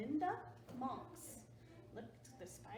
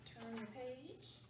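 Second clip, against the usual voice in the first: ordinary delivery, nothing marked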